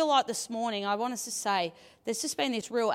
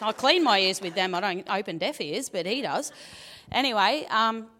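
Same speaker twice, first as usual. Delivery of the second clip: ordinary talk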